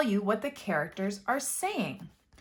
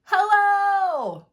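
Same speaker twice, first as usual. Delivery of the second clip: "Hello?" yelled much higher and louder, stretched out; yelling; 12 semitones higher, 9 dB louder than usual. Shown as a demonstration. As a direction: call "Hello?" out at full voice. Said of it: "Will he be yelling".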